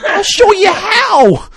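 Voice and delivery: high, slow fake Brooklyn shout; hammed-up Brooklyn kid yelling; Brooklyn accent. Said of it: "with a fake Brooklyn accent".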